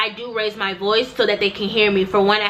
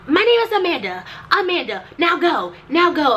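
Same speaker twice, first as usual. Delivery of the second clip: higher, curt voice for Amanda; imitating Amanda snapping her name and telling her to go; mimicking Amanda; 7 semitones higher than usual. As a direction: dismiss her, snippy and short. Receptionist voiced naming herself.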